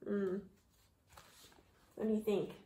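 ordinary delivery

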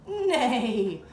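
loud, long playful neigh; big held horse whinny. Pretend. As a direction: neigh out like a horse for small children. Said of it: "like a horse".

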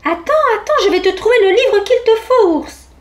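high little voice, eager and loud; small mouse voice. A narrator's reading. Mouse offering to find bear a book, small high voice. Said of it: "une petite voix".

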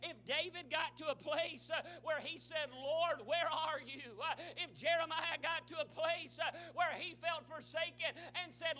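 loud, high-pitched driving sermon; full-force preaching. Real, not acted. Preacher promises church they will doubt, fervent preaching. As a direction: drive it hard to the congregation.

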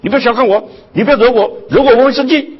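high, loud, swaggering claim of importance; mock loud self-important boast. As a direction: announce yourself like a big shot at a restaurant. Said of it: "他只是发出一个信号说".

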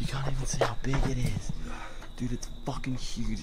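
hushed whisper, barely voiced. Real, not acted. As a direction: whisper so nobody hears you at night. Sneaking a trampoline at night.

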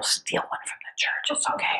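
whispered, like a naughty secret; conspiratorial whisper. Pretend. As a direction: lean in and whisper it as a joke. Joking aside to the audience.

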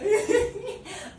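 loud, high-pitched giggle burst; shrieky laugh; high squealing laugh. Real, not acted. Laughs at own joke.

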